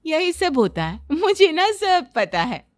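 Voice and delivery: animated, emphatic boasting; swooping, self-assured storybook character voice; know-it-all daughter-in-law. Acted. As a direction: lecture them like an expert on everything. Daughter-in-law boasts about knowing everything.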